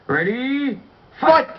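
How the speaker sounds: shouted round-start call; fight announcer call. Pretend.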